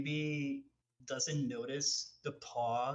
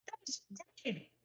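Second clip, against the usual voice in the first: very high, softer, halting character voice; high-pitched, unconvincing compliment on an untasted drink; stumbling over words; 12 semitones higher, 5 dB quieter than usual. Acted. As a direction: force out praise haltingly. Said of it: "él está stumbling to say the words".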